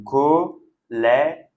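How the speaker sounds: "collège" very slowly as co-llè-ge, open "è" then neutral "e"; two E sounds. Shown as a demonstration. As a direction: break "collège" into three separate syllables. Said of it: "on ne prononce pas de la même façon". (collège très lentement, syllabe par syllabe)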